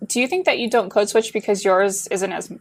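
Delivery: ordinary delivery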